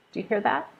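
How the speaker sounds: ordinary talk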